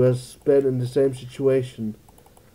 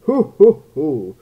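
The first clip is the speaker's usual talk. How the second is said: mock British accent; 3 semitones higher, 8 dB louder, slower than usual. Shown as a demonstration. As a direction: hoot like a mock Brit, falling at the end. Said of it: "how do I do the British accent again?".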